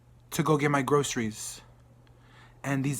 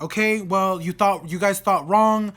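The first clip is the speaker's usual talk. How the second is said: raised, testy correction; higher-pitched exasperated retort; lost patience; 4 semitones higher, 5 dB louder than usual. Retold or imitated. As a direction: set them straight impatiently. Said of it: "I've lost my patience".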